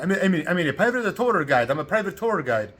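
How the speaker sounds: put-on loud, high Italian-accented insistence; mock Italian guide repeating himself. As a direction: ham up the Italian guide's English. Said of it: "in an Italian accent".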